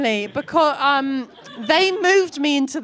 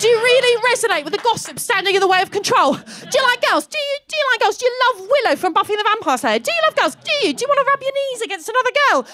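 much higher and louder, fast questioning; frenzied taunting; 9 semitones higher, 5 dB louder, faster than usual. Retold or imitated. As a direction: pile the questions on, manic. Frenzied schoolgirl interrogation.

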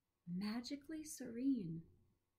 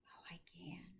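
very hushed, near-whispered answer; soft reply; 3 semitones lower, 14 dB quieter than usual. A narrator's reading. Dying professor answering his visitor, said softly. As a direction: drop right down to a gentle whisper. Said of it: "he said softly".

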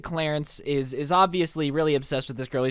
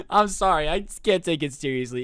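ordinary talk

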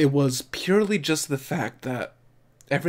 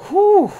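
big high relieved exhale, much louder; loud high whew; 11 dB louder than usual. Real, not acted. Viewer shaking off the emotion with a loud whew.

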